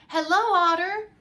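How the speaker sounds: high, loud greeting across the river; calling out. Acted. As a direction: call out to Otter on the bank. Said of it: "shouted Little Hedgehog".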